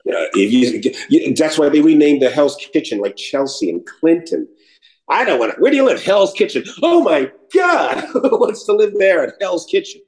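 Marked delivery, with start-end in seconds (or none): 5.1-10.0 high, hammed-up horrified wail; mock horror. Mocking people who shun Hell's Kitchen, high mock horror.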